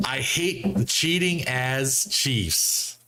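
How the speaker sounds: slow, drawn-out mock outrage; each word stretched for effect; mock indignant. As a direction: read it out slowly with heavy emphasis. Reads out angry fan's post.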